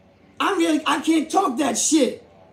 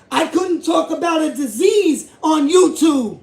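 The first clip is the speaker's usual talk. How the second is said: emotional outburst; 3 semitones higher than usual. Real, not acted. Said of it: "I'm getting emotional".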